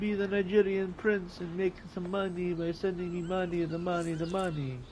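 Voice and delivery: put-on scammer pitch in a jokey Nigerian accent; mock Nigerian accent. Streamer mocking a Nigerian prince scam, in a hammed-up Nigerian accent. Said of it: "I don't wanna hear the Nigerian accent anymore".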